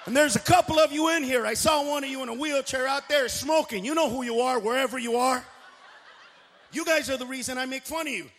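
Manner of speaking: normal talking voice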